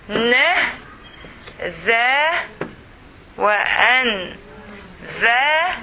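each sound of "Wa, a, n, za" said alone, long pauses between; sounded out separately. Shown as a demonstration. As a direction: spell out "Wa, a, n, za" sound by sound. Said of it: "you can divide here".

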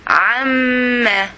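"عما" drawn out slowly, the doubled mim held; every letter clarified. Shown as a demonstration. Teacher modelling for pupils to read after, "عما" slow and clear. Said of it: "try to clarify every letter".

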